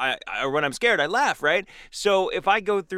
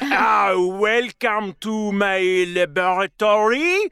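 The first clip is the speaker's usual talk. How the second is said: put-on slow, loud, high mad scientist with a French accent; hammed-up French mad doctor; 7 semitones higher, 4 dB louder, much slower than usual. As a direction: roll out the welcome like a French horror host. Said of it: "be like".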